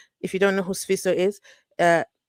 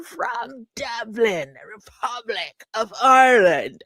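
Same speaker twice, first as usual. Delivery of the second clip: slow, slightly louder attempt at Irish; failed Irish accent; 3 semitones higher, 5 dB louder, slower than usual. Greeting a viewer in Dublin with a failed Irish accent. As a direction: try an Irish accent and miss it. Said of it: "trying to bring out my Irish voice".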